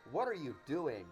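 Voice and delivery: ordinary delivery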